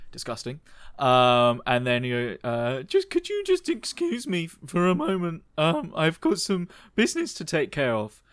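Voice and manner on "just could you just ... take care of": high polite excuse; posh polite voice. Acted. John excuses himself from Margaret, high polite voice. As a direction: lift up prim and proper.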